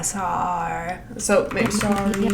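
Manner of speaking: "sar" drawn out slowly; slow demo. Shown as a demonstration. Coach contrasting mouth shapes, slow. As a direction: stretch "sar" then "sorry" slowly. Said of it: "more like a".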